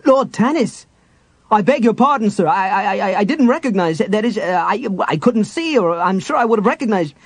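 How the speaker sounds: high, stammering, apologetic guard; flustered guard. Acted. Guard realizing he stopped Lord Tanis, high flustered stammer. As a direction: trip over the apology in a panic.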